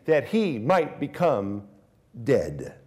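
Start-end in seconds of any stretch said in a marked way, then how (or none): none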